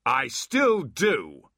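slow, loud, high roar. Acted. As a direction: roar it defiantly. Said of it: "roared Abbott".

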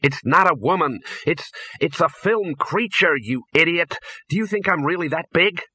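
high, exasperated shout. A narrator's reading. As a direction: yell back at the Dean. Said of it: "shouted Ginger".

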